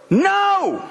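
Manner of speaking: imitating a toddler's high protest. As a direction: squeak it out like a two-year-old grandson. Grandson voiced in a story.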